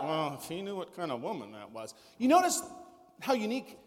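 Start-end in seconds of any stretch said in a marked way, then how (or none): 0.0-1.9 much softer and lower, a disdainful aside; muttered scorn from Simon the Pharisee; a quiet, judging inner voice; Simon's scornful thought. Retold or imitated. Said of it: "Simon reasons in his heart".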